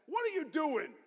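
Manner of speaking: high-pitched indignant demand; indignant outburst. Pretend. Man sitting up in his coffin at his funeral, indignant outburst.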